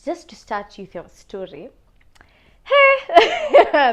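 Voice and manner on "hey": a high, loud laughing hello; bright laughing hey to the camera; excited hey. Real, not acted. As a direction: burst in with a laughing greeting. Cheery greeting to viewers.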